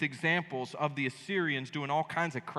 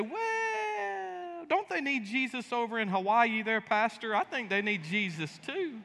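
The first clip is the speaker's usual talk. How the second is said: mock excuse-making; 6 semitones higher, slower than usual. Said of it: "You'd say".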